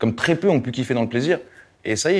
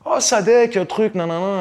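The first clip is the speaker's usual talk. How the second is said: high squealing fan voice, much higher; mock fangirl; 10 semitones higher, slower than usual. Imitating a woman gushing over him as a fan. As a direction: gush like an excited fan. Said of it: "Elle fait la fan".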